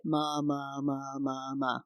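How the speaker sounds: soft, low, toneless chanting of syllables; lifeless monotone. Shown as a demonstration. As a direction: drone it softly on one note. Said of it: "if you said it like".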